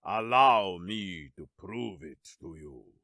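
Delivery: low, smooth villain voice; deep, silky and self-assured. A narrator's reading. Villain Askar's voice.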